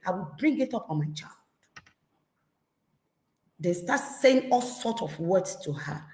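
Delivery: ordinary talk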